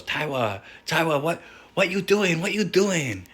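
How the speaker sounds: high, loud imitation of a Japanese boss's accent, calling Taiwa.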